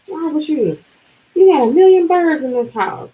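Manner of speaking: high, exasperated scolding; mother's scolding. Retold or imitated. Mother scolding her over the birds, high exasperated. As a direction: nag like a fed-up mom. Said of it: "She was like".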